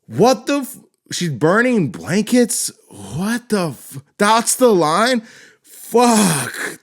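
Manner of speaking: high, drawn-out real incredulity; genuinely aghast, high and slow; appalled disbelief. Real, not acted. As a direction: stretch each word in scandalized disbelief. Appalled by the film's dialogue.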